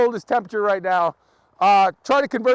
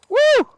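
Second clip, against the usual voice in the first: sudden high whoop, much louder; excited whoop; 8 dB louder than usual. Real, not acted. Vlogger braving minus ten, whooping loudly at the cold. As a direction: let out a wild yelp at the biting cold.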